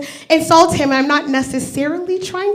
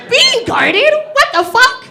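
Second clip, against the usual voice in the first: disbelief; 10 semitones higher than usual. Retold or imitated. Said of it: "reacting to mother".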